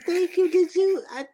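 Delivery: high, sweet-talking mock thanks; high-pitched fake courtesy; fake polite voice. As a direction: put on a high, over-polite send-off. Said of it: "that fake ass voice".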